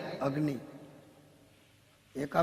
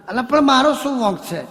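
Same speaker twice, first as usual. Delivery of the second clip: pleading in high, loud protest; indignant protest; 6 semitones higher, 6 dB louder than usual. Acted. Husband protesting to his friend, indignant and loud. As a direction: plead your innocence.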